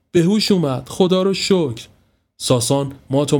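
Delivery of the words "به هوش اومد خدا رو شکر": high-pitched, mildly tearful relief. A narrator's reading. Mother sees her son come round, tearful relief. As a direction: cry out thanks, a touch weepy.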